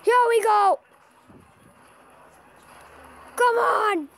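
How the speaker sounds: high, hyped cheering; excited urging. Real, not acted. Player willing the giant onward, excited urging.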